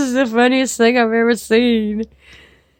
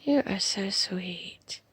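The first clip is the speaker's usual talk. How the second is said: whispered, tender and hushed; a soft loving whisper; fond whisper; 6 semitones lower, 17 dB quieter than usual. Real, not acted. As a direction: breathe it to the picture like a secret. Whispering fondly at the picture.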